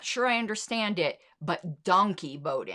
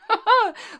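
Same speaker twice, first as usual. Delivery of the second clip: high bursting laugh; 8 dB louder than usual. Real, not acted. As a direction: laugh at your own outburst. Laughs after own outburst.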